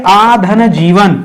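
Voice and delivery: आधन जीवन drawn out very slowly; slow word split. Shown as a demonstration. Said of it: "ये आजीवन जो शब्द".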